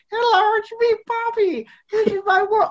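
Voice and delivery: very high-pitched little kid cartoon voice; Bobby impression. Fans want to hear Bobby again, high-pitched mock kid voice. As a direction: squeak it out like a cartoon little boy, far above your own pitch. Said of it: "I forget what Bobby actually sounds like".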